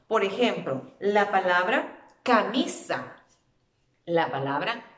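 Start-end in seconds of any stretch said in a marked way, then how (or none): 2.2-3.1 camisa with MI punched louder; ca-MI-sa, weight on the middle syllable; stress on MI. Shown as a demonstration. Teacher giving an example word, camisa stressed on mi. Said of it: "Dónde recae la fuerza de la voz".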